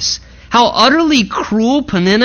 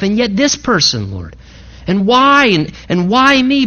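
higher-pitched, anguished questioning of God; pleading questioner; 6 semitones higher than usual. Acted. Believer asking God why this is missing, pleading. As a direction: plead upward, drawing out each why. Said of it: "We, like Hannah, are going".

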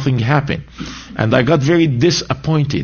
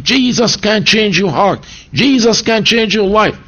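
raised, ringing preacher's call; preacher's proclamation; 7 semitones higher than usual. Retold or imitated. Preacher at the conference proclaiming to the crowd, fervent. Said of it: "preaching and saying".

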